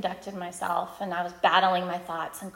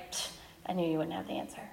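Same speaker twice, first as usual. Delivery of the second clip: grumbling retort; 4 semitones lower, 10 dB quieter, faster than usual. Retold or imitated. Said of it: "I kinda grumbled at him".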